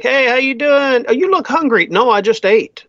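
a hint of a doting host pushing food; Italian mom. Voicing a pushy Italian host.

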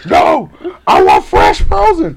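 loud, slow, insistent mock demand; yelling customer. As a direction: raise it as the stubborn customer. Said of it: "He's yelling".

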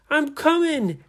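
high, loud call; heroic call. A narrator's reading. Nora calls out mid-rescue.

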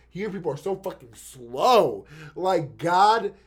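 slowed, exasperated griping at slow people; drawn-out annoyed swearing at traffic; biker rant. Retold or imitated. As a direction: vent like a fed-up cyclist mid-ride. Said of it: "this is literally how I sound like when I'm on my bike".

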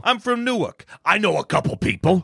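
high Jersey Italian tough guy; Jersey Italian accent. Retold or imitated. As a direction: brag like a Newark wiseguy. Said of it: "American Italian".

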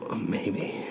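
breathy whisper to a neighbour; whispered aside on the train. Real, not acted. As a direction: keep it a secret, just air. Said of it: "What are you whispering about?".

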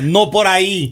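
high-pitched comic cry; mock disoriented neighbour. Disoriented neighbour calling out in bed, high mock cries. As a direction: imitate a woman crying out directions. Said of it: "la vecina desorientada".

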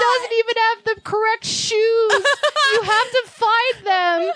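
high, frantic pleas to the police; panicked pleading. Retold or imitated. Retelling the police call, high panicked voice. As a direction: pitch it way up in panic.

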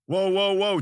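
high-pitched panicked protest; alarmed backpedaling. A narrator's reading. Captive seeing the battle axe, alarmed. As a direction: plead for a halt in alarm. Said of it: "changed his tune when he saw the blades".

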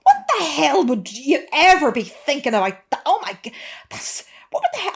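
high, loud, shocked mum voice; mock a scandalised Irish mother; outraged mum. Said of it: "She went".

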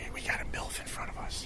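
hushed whisper about a passerby. Real, not acted. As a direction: whisper so she can't hear.

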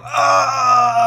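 put-on high, loud cry of an old man falling; retold cry of pain. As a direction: shout out as he hits the ground. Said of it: "a guy go".

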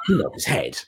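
ordinary talk, nothing marked

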